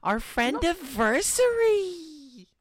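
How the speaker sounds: high, loud, slow excited cry; excited squeal. Real, not acted. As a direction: squeal it with glee. Excited about anniversary.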